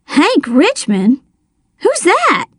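slow disbelief leaping into high bafflement; puzzled question, pitch shooting up; shocked gasp. A narrator's reading. Narrator hears the wrong winner's name, gasped surprise. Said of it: "I gasped".

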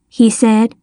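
usual speaking voice

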